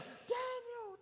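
a high call of the name down the pit. Acted. Darius calling to Daniel in the den, high call. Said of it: "And he calls down".

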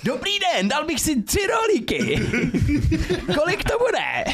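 very high, slow squeaky voice with laughs; silly kid voice. Pretend. Pretending YouTubers stuck talking like that in a shop, squeaky and high. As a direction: ham up a squeaky child shopper. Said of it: "budou takhle mluvit potom normálně".

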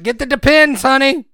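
high, loud call across the store; loud call to wife. Retold or imitated. Calling to his wife in Walmart, high and loud. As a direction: blurt it out for everyone to hear. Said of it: "I said it real loud around people".

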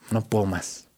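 barely audible, low, worn-out murmur; defeated murmur. Retold or imitated. Podcaster recalling his breakdown, a soft defeated murmur. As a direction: let it fall out of you, spent.

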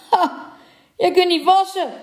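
high, loud mocking troll crone; old troll woman. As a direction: cackle it out, high and loud, gloating. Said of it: "zei de oude trollenvrouw". (hoge, luide spottende trollenvrouw)

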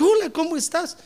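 bright, high friendly hello; joyful high greeting; cheerful greeting. Retold or imitated. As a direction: greet him with real cheer. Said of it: "con alegría le dije".

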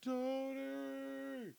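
high, thin far-off calling of a name; a faint name cried from far off; distant call. Retold or imitated. Boss calling from across club.

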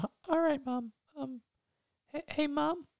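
put-on high little kid voice; little boy voice. Little Billy confessing to his dad in a high childish voice. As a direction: squeak it out like a guilty small boy.

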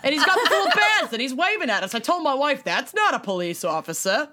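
put-on botched New York accent that lands in Boston; Boston-sounding accent. Driver quoting what he told his wife, Boston-sounding accent. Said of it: "You sound so Boston".

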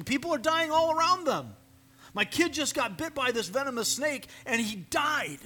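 preacher voicing villagers bitten by snakes, panicked; desperate Israelites. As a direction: cry out as a frightened villager.

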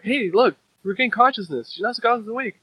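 high, swooping mock excitement; excited calling. Reader voicing onlookers as the fighter wakes, excited.

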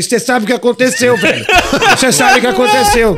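retold outburst, high and loud; indignant shout. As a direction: yell the question in comic outrage. Acted angry phone call, repeated question.

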